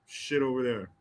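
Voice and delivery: ordinary delivery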